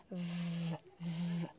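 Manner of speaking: V soufflé, plus doux; sounding out V. Shown as a demonstration. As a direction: faire chanter le son V doucement. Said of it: "Je cherche un mot qui commence par v". (son V chanté doucement)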